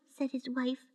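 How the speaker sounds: plain reading voice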